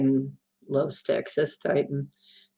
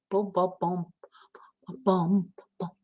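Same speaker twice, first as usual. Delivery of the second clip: softly whispered beat; whispered boom; 10 dB quieter than usual. Pretend. Humming a song to herself, a whispered beat. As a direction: whisper the booms like a drumbeat.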